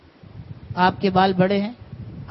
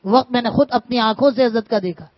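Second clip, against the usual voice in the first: loud, pitched-up sermon delivery; preaching at full force; 4 semitones higher, 7 dB louder than usual. Acted. Urging students and scholars to take it to heart, loud preaching.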